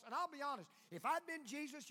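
ordinary talk

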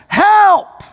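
high, loud cry for help. Retold or imitated. Frustrated man cries out to Jesus, high and loud. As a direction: call out desperate and loud. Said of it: "he cries out to Jesus".

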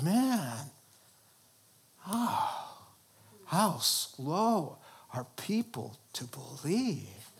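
lightly acted sigh of disappointment; weary dismay. Jesus lamenting people slow to believe, weary dismay. Acts Jesus sighing at doubters.

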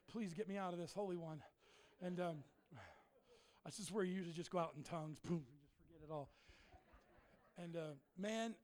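ordinary talk

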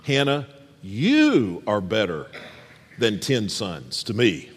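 gentle, sincere husband's reassurance; tender reassurance. Shown as a demonstration. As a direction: say it like you truly cherish her. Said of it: "But he should have said".

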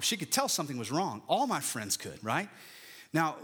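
normal talking voice

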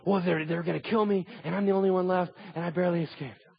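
high-pitched, plaintive prophet; mock panicked complaint. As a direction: whine it as a put-on plea. Elijah whining to God, acted.

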